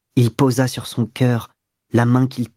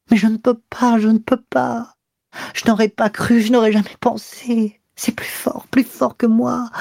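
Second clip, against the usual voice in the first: higher, breathless, near tears; panting anguish; 8 semitones higher than usual. A narrator's reading. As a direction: confess it gasping and breaking. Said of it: "d'une voix haletante".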